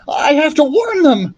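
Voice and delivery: high, pushed shout; shouting over noise. A narrator's reading. Jack shouting to Thomas over engine noise, high and urgent. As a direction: yell it over roaring jet engines. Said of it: "he shouted to Thomas above the noise".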